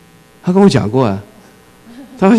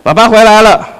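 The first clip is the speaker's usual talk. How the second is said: a touch of a weary dad; 9 semitones higher, 6 dB louder than usual. Retold or imitated. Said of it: "拖着疲惫的身心".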